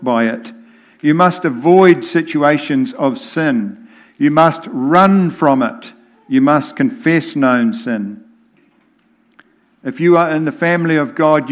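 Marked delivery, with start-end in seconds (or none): none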